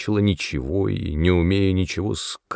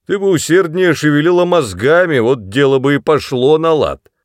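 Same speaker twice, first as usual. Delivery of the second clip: put-on lecturing drunk father; 7 semitones higher, 7 dB louder than usual. A narrator's reading.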